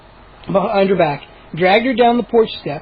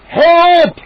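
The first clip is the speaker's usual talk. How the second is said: loud high shout; calling out; 10 semitones higher, 7 dB louder than usual. Acted. Moose yelling for help while running. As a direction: yell it across the yard. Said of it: "Moose called".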